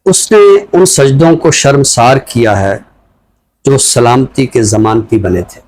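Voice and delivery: slow, measured reading of a free verse poem; poem recitation. Acted. Host asked for a poem, he recites it slowly. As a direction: let each line land with a pause.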